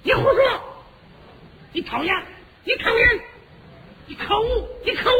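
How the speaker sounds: high, shrill quarrelling woman; squabbling wife. Acted. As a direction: bicker like an affronted lady. (尖声吵架的夫人)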